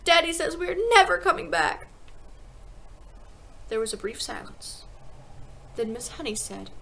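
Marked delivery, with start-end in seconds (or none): 0.0-1.9 put-on high, loud child's protest; upset little girl, pitched high; anguished cry. A narrator's reading. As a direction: cry it out in dismay. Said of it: "Matilda cried".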